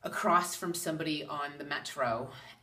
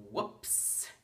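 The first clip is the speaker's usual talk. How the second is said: sheepish whispered "Whoops"; 6 semitones higher, 3 dB quieter than usual. Retold or imitated. Caught by a stranger's higher self, sheepish whisper. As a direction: breathe it out as a caught-out oops. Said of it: "I was like".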